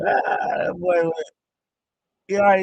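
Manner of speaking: usual speaking voice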